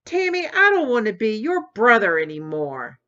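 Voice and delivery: a brother shouting at his sister over the unfair choice. Acted. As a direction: shout it out in protest. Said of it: "shouted Tommy".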